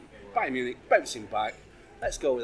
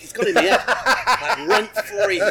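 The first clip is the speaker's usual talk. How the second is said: laughing through the words; giggling as you say it; 7 semitones higher, 10 dB louder than usual. Real, not acted. Laughing at a teasing remark.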